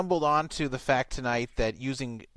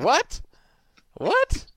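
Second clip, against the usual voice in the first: two rising squeaks, the second much higher; confused mock yelp; 6 dB louder than usual. Baffled floorman squeaking What? What?, rising each time. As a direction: act out a baffled man's shocked double take. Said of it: "He'll be saying".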